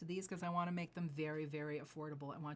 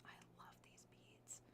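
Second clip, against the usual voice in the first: hushed, breathy awe over the jade; whisper; 20 dB quieter than usual. Real, not acted. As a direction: whisper it like a secret treasure. Admiring beads close up.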